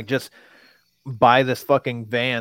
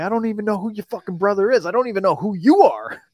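higher, fast, indignant replay; exasperated retort; 9 semitones higher, 4 dB louder than usual. Retold or imitated. Retelling his answer to the angry kid, fast and indignant. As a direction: fire it back at him, fed up.

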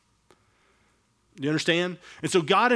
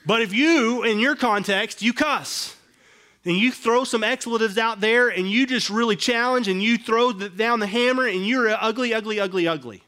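higher and louder, building; fired-up preaching; 5 semitones higher, 4 dB louder than usual. Retold or imitated. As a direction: hammer it out with rising heat. Describing boss losing temper.

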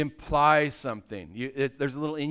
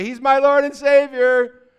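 high, loud, slow declaration; drawn-out high shout in retort; loud proclamation; 8 semitones higher, 12 dB louder than usual. Acted. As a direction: proclaim it loudly, stretching it out. Repeats his loud retort.

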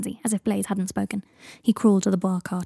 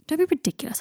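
higher-pitched, quick objection; exasperated dismissal; 7 semitones higher than usual. Acted. Harry objects to Draco's farewell, exasperated. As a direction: brush the goodbye aside.

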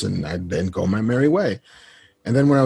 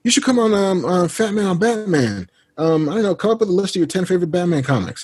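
lightly imitated friend, higher pitch; breezy pitch in a friend's higher voice; Kevin impression; 9 semitones higher than usual. Friend inviting him onto a Batman podcast, lightly imitated. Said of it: "It's like".

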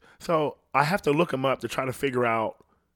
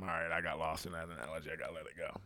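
very quiet, low self-correction; low, hushed aside; muttered aside; 8 semitones lower, 16 dB quieter, faster than usual. Real, not acted. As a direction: drop right down and mutter to yourself. Dropped aside after losing the analogy.

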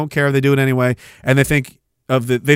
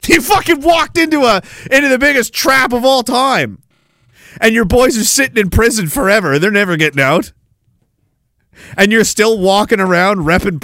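angry rant; 7 semitones higher than usual. Real, not acted. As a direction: tear into him, voice way up. Ranting at Proud Boys member.

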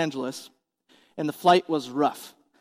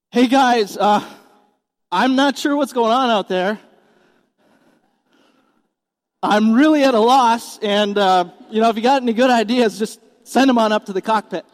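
loud, higher, hesitant mock pilot; clueless captain; 4 semitones higher, 5 dB louder than usual. Captain asking passengers for ideas, loudly and casually clueless. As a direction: ham up a casual captain who is at a loss. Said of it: "Does he say".

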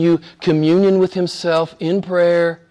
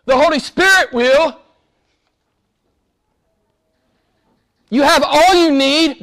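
high, forceful and driving; preacher insisting the church lacks nothing; insistent preaching; 9 semitones higher than usual. Real, not acted. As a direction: hammer it home, you have all you need. Preacher insists, repeated refrain.

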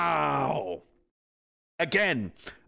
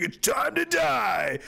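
slow, higher shouted threat; menacing hero; 9 semitones higher, much slower than usual. Retold or imitated. Brock Lesnar threatening the activists, slow shout. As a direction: growl out the threat slowly. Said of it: "he's just like".

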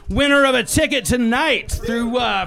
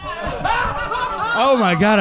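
delighted gasp; 9 semitones higher, much slower than usual. Real, not acted. Delighted by the question.

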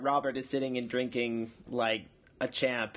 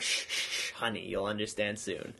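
condescending hush; 5 semitones lower, 5 dB quieter than usual. Retold or imitated. Council hushes Ned condescendingly.

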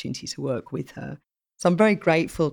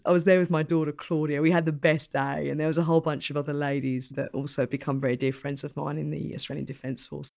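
ordinary delivery

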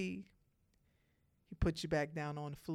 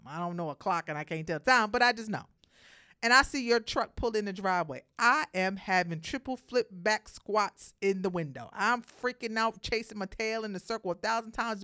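excited; 3 semitones higher, 7 dB louder than usual. Pretend. Acting as an excited puppy.

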